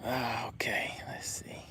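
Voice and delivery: breathy whisper under his breath. Real, not acted. Streamer checking the chat, whispering to himself. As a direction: whisper to yourself while scrolling.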